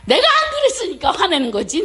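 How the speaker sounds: mock-heated protest, voice jumping up; hammed-up indignant answer to husband; retold indignant retort. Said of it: "또 이러는 거예요".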